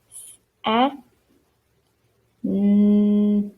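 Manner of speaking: "s", "a", "n" each sounded alone, with gaps, the n held; separate sounds. Shown as a demonstration. Teacher shows what not to do, sounding "s", "a", "n" separately. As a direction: break it into isolated sounds "s", "a", "n", humming the last. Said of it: "We are not spelling it separately".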